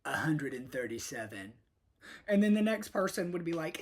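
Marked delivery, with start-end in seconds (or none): 0.0-1.5 mock psychic. Psychic answers with a number.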